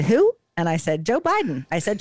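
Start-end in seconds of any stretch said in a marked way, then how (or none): 0.0-0.3 high, loud mock-baffled question; retold bafflement. Husband hearing who is president, imitated baffled high question. 1.0-1.7 high-pitched retold reply; retold answer. Wife updating amnesiac husband, retold high-pitched answer.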